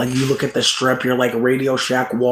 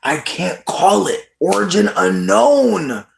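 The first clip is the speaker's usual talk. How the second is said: slow deliberate mock verdict; 3 semitones higher, slower than usual. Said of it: "I'm like".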